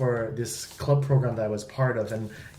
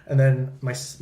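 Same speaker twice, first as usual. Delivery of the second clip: normal talking voice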